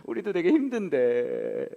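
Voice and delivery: slow, hammed-up crying sympathy; mock tearful sympathy. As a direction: drag it out like sobbing comforters. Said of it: "얘네도 앉아서 막 울고".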